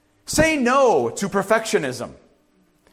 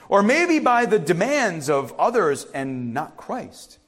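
ordinary talk

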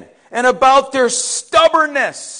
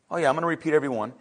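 ordinary talk

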